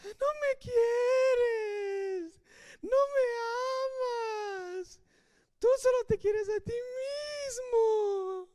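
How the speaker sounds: high-pitched exaggerated wail, words drawn out; hammed-up sobbing whine, long stretched vowels; mock crying. Wife guilt-tripping Samson to get his secret, mock crying. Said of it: "Empezó a llorar".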